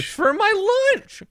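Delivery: very high, drawn-out squeal; slow, exaggerated falsetto whine; mocking the lady. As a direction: mimic her excuse in a silly high voice. Mocks the lady's weak excuse.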